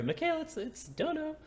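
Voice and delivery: absurdly high character greeting; put-on high voice. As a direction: squeak it far above your normal pitch. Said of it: "I'm not sure that's how Mikhail usually sounds".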